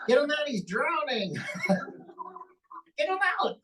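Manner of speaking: high panicky cry for help; panicked puppet. Acted. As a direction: shriek for help like he's drowning. Puppet panics, someone drowning.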